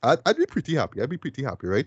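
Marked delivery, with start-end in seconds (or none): 1.0-1.7 a quiet echo to yourself; soft echo. Shown as a demonstration. Reviewer echoing his own hope softly, much softer. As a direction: repeat it much softer, under the breath. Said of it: "repeats line, second softer".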